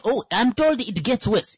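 ordinary delivery

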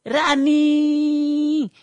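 "Running" held long on a high, level pitch; a long high chant of "Running"; stretched "Running". Shown as a demonstration. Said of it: "speaking English with a Timbuktu accent".

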